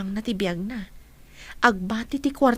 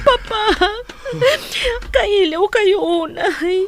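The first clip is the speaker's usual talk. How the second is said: high, loud, slow tearful cry; tearful longing; 10 semitones higher, 5 dB louder, slower than usual. Acted. Daughter telling father she missed him, tearful and slow. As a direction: ache through each word.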